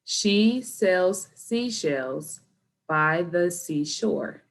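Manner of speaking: very slow, each word pointed out; slow tongue twister. Shown as a demonstration. Teacher pointing to each word, slow and careful. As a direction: take it slowly, word by word. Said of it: "let's slow down in the beginning".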